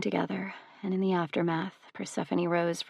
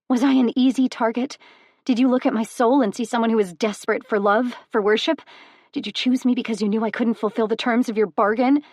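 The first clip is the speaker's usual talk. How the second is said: higher and louder, fast furious accusations; furious outburst; 4 semitones higher, 7 dB louder, faster than usual. Acted. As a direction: hurl the questions at him in rage.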